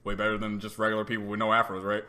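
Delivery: low, fast buddy voice; lightly voiced bro hyping you up; mock flattering dude. Stranger flattering the speaker about his afro, low quick mock bro voice. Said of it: "someone coming up to me and being like".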